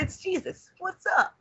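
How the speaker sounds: bright, high Jesus greeting. Acted. Jesus popping in to greet Kamina, high and breezy.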